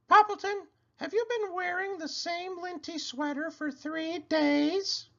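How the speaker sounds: a llama giving friendly advice; Cherry Sue voice. A narrator's reading. Llama advising her friend the pig, high and sweet. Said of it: "asked Cherry Sue".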